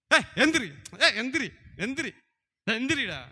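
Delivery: high, loud, repeated bursts; sharp, high-pitched calls to a sleeper; acted wake-up shout. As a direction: yell at someone to get up. Acting out shaking a sleeper awake.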